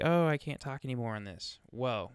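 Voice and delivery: ordinary delivery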